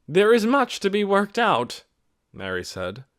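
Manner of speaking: Mary's public address. A narrator's reading. Mary speaking out to the village meeting, firm and carrying. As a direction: address the whole meeting firmly.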